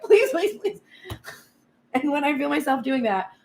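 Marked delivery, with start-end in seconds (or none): 0.0-0.8 high-pitched rapid begging with a laugh; mock-beg in a high voice, close to laughing; desperate pleading. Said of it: "of course I'm gonna be like".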